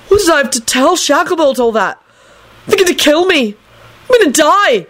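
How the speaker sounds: high-pitched melodramatic wail. A narrator's reading. Neville telling Trevor he is doomed, melodramatic. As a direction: wail it in exaggerated dread. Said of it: "he told Trevor melodramatically".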